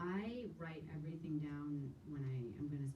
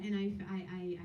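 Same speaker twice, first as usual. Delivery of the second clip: ordinary talk, nothing marked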